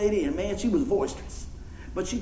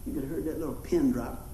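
normal talking voice

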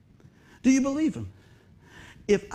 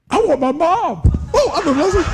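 panicked cry; 7 semitones higher, 7 dB louder than usual. Retold or imitated. Panicked quote in shop accident.

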